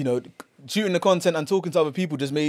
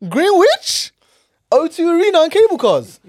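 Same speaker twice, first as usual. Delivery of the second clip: loud, high-pitched disbelief; scornful, high and loud exclamation; incredulous mockery; 12 semitones higher, 6 dB louder than usual. Real, not acted. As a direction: repeat the place as if it were absurd. Mocking disbelief at rival's area.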